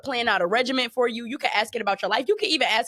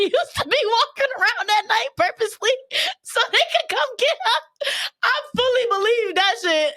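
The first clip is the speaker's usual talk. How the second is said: excited storytelling; 12 semitones higher than usual. Real, not acted. Excited retelling [name] story.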